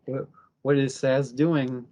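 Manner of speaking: usual speaking voice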